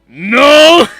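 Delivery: loud startled no; loud denial. Acted. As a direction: bark the denial. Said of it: "King Ryman goes".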